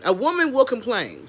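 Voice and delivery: ordinary talk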